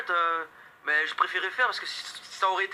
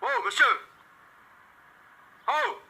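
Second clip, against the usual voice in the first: calling out; much slower than usual. Acted. Calling out to blocking driver.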